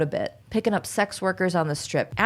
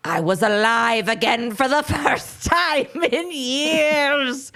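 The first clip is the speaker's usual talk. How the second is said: high, slow, melodramatic wail; ancient dying man; 7 semitones higher, slower than usual. Acted. Podcaster voicing the suspect's last confession, high tearful ancient-man wail. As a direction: cry it out as if centuries old. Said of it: "In two hundred years".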